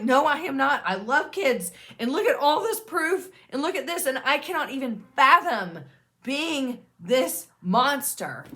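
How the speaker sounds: high, loud self-defence; insistent, incredulous denial, slowing at the end; indignant protest. Acted. Acting a sufferer's indignant self-defence.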